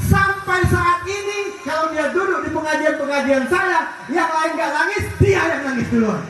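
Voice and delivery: high, drawn-out cry of wonder to the congregation; impassioned preaching. Real, not acted. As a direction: preach it high, stretching the key words.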